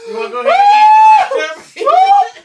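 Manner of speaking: high, loud laughing shout; laughing hard. Real, not acted. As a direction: crack up while teasing.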